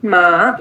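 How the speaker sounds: long माँ, low with a rising tail; third tone. Shown as a demonstration. Teacher echoes a student approvingly, third-tone माँ, low then rising. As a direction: let माँ dip, then climb. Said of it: "this is third tone".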